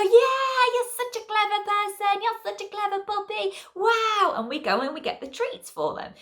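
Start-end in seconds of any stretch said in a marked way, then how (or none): 0.0-4.3 high sing-song puppy praise; over-the-top praise for a wee on the grass. Retold or imitated.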